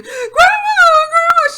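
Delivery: high, loud panicked shout; child screaming. Retold or imitated. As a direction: scream for grandma as she gets out. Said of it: "is screaming".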